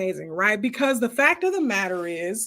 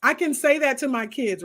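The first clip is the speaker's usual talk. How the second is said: ordinary talk, nothing marked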